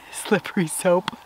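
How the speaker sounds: laughing slip. Real, not acted. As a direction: laugh off the slip, breathy whisper on soap. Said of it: "Slippery slope".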